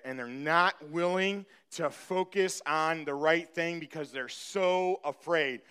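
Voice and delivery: ordinary delivery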